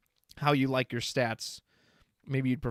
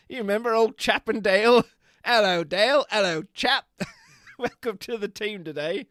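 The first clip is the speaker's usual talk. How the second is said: loud, higher-pitched mock English chap; 6 semitones higher, 7 dB louder than usual. Greeting Chap and Dale as teammates, playful English chap voice. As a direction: play a jolly chap greeting his pals. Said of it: "badly spelled English chap".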